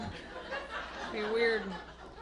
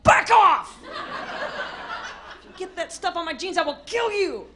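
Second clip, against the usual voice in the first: loud, high burst on the first words; hammed-up exasperated threat; mock angry warning; 5 semitones higher, 5 dB louder than usual. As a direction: warn the kids off, then threaten them. Acted snapping at messy kids.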